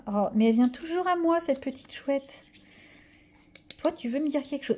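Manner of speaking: cooing at a little owl; tender talk to the owl. Real, not acted. Talking to owl card. (voix douce et aiguë pour la chouette)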